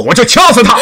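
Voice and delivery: high, loud menacing burst; cornered kidnapper's loud threat; shouted threat. Acted. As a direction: threaten to kill the hostage. Kidnapper threatens the hostage child.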